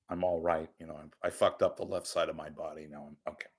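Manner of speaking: a quiet half-hearted rerun of the joke; repeating the pun under his breath after it flops; muttered retake. Shown as a demonstration. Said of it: "Okay, it's a, come on".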